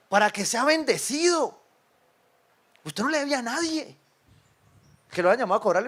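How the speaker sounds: ordinary talk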